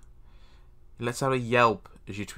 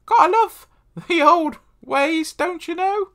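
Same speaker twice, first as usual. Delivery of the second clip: very high, loud, drawn-out posh British squeak; shrill comic Englishman, slow and loud; grumbly British voice; 10 dB louder, slower than usual. Acted. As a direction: ham up a grumbling Brit. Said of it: "a voice that sounds like a mix between a grumbler and a British accent".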